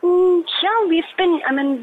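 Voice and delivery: ordinary talk, nothing marked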